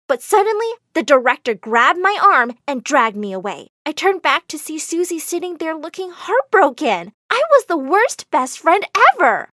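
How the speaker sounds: ordinary delivery, nothing marked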